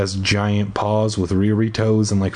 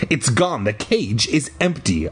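ordinary delivery

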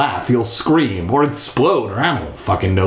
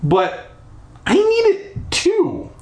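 high sputter; slower than usual. Acted. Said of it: "I sputtered".

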